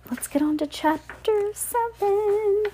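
high slow sing-song announcement; high, slow storytime call; cheerful announcement. Acted. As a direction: lift it up for a child.

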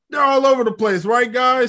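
sarcastic. Retold or imitated. Boastful Loki mocking the other Lokis' plan, sarcastic. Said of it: "sarcastically says".

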